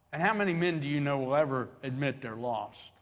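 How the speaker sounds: ordinary talk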